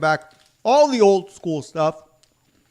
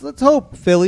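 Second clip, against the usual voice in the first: excited; 10 semitones higher, 8 dB louder than usual. Real, not acted. Hopeful exclamation.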